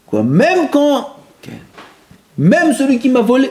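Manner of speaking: monte haut, plus fort, lent; proteste, incrédule; élève indigné. Retold or imitated. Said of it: "élève qui objecte". (élève qui s'étonne, voix haute)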